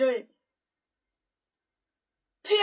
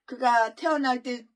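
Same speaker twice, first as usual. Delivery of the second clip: normal talking voice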